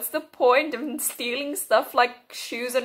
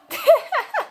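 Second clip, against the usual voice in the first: shrieking high laugh, louder; 5 dB louder than usual. Real, not acted. At the new song, high squealing laugh. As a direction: burst out squealing.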